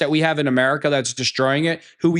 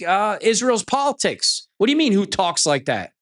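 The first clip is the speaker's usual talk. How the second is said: usual speaking voice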